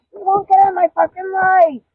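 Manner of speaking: exploding in anger about the other woman; furious outburst. Real, not acted. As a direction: let it burst out, fed up. Furious rant, quoting the situation.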